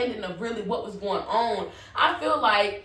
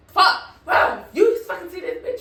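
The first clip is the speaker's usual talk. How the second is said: loud, high burst of real anger; angry outburst; 8 semitones higher, 6 dB louder than usual. Real, not acted. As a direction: let it explode at the injustice. Outburst at cop's aggression.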